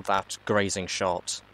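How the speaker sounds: ordinary delivery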